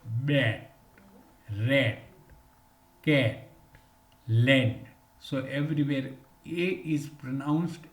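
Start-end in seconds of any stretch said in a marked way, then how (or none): 0.0-4.8 "bat, rat, cat, land" with a clear short a, one word at a time; short a drill. Shown as a demonstration. Teacher showing the short a sound, careful drill. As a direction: say each word crisply with a short a vowel. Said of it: "Just we pronounce".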